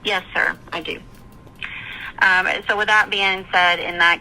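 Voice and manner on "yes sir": usual speaking voice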